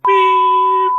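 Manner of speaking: very high loud electronic beep; imitated beep. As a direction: sing out a sharp tone like a PA chime. PA system tone.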